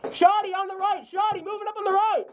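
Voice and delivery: high urgent warning yell; shouted callout. Real, not acted. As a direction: shout the enemy's position. Calling enemy to team.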